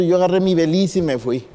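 normal talking voice

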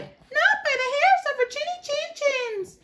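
very high squeaky refusal; little pig refusing the wolf, high and defiant. A narrator's reading. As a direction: squeak back bravely. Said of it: "the little pigs replied".

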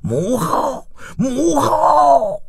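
high, drawn-out eager call; high-pitched excited calling. Acted. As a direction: call out to your mother before you reach the door. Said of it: "声音就飘了进来".

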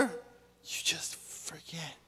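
hushed aside, barely voiced and slow; whisper. Pastor whispering the joke about old age to the congregation. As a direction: drop to a slow secret whisper.

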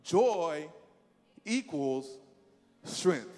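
each word set apart with long pauses; slow and emphatic. Shown as a demonstration. Preacher leading the church, pausing between words. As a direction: lay it down word by word. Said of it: "Now say it with me".

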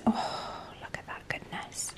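hushed, awed whisper. Real, not acted. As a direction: breathe it like a secret treat. Admiring food in brochure.

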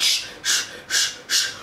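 breathy sucking shh sounds; imitating inhaling gas and air; gas and air breathing. Mother on gas and air, imitating rapid shh breaths. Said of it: "I'm taking it every five seconds".

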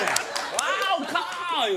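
high, very slow drawn-out wow; mock foreign cheer. Storyteller imitating an amazed tourist, slow drawn-out high cheer. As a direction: stretch the wow way out like an excited tourist. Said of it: "言うて".

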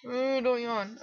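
usual speaking voice

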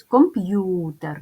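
Комп'ютер slowly, with the stress on п'ю. Shown as a demonstration. Teacher marks the stress for first-graders, slowly. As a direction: lean on the second syllable п'ю in Комп'ютер. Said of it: "Ставимо наголос".

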